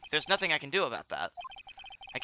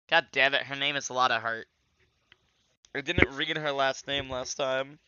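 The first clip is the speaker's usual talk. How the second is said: usual speaking voice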